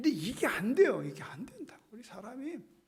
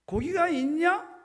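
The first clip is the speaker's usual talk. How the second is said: called-out question; 8 semitones higher, 7 dB louder, slower than usual. Acted. Jesus asking disciples loudly.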